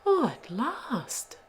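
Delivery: high, slow, swooping relief; relieved delight. A narrator's reading. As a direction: sigh it out with swooping pitch. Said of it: "exclaimed Rollo".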